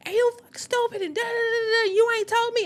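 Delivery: mock the tearful outburst, voice cracking high; tearful going off. Cursing out her man through tears, high crying rant. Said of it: "I'm going off".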